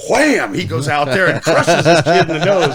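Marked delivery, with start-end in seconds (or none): 0.1-0.5 loud shouted wham sound effect; mock impact cry. Telling the hockey fight story, a loud shouted wham. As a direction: punch it out like a cartoon hit.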